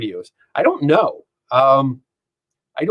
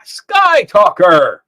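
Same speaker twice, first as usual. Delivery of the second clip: loud, high, drawn-out name call; playful announcer shout; 6 semitones higher, 8 dB louder, much slower than usual. Pretend. Streamer hamming up a viewer's name, loud announcer call. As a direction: stretch the name out big and loud.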